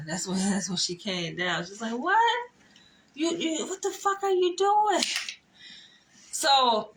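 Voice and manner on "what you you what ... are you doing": high-pitched, incredulous mock outrage; imitated outraged confrontation. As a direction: act out her shocked challenge, pitch jumping way up. Retelling the angry woman's confrontation.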